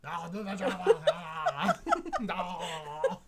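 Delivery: a deliberately bad Austrian Arnold accent, laughing. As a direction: ham up a poor Austrian movie-robot accent, cracking up. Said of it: "I cannot do an Arnold accent".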